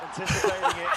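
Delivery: high call breaking into laughter; laughing. Real, not acted. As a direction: laugh while saying it. Caster laughs through the call.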